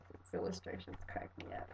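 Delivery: breathy whispered aside. Real, not acted. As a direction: whisper it while amused. Whispered amid laughing.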